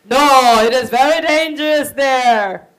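loud, forceful protest; insistent warning. Acted. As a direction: refuse to let her go. Chief insists again.

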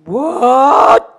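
loud, drawn-out disbelief; outraged shout. Acted. Father hears daughter's new faith, outraged shout. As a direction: bellow it as a shocked father.

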